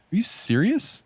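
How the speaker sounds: ordinary talk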